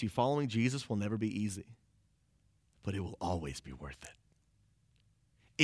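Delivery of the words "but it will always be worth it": very low, slow and soft; hushed assurance. Real, not acted. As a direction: drop right down to a quiet, unhurried promise. Preacher softens promise, then repeats it.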